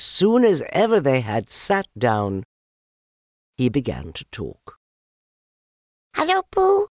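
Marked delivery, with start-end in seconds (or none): 6.2-6.9 very high little squeak. Acted. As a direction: squeak it like a tiny joey. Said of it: "he squeaked".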